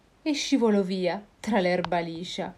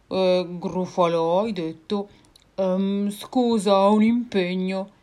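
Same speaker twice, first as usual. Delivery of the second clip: louder, stammering monster; flustered monster; 5 dB louder than usual. Acted. Gruffalo making an excuse to flee the mouse. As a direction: back out nervously.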